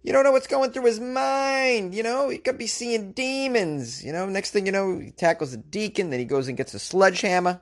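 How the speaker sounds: high-pitched, alarmed Boston parishioner; Boston accent. Acted. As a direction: fret in a broad, put-on Boston accent. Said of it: "I slipped into this Boston accent".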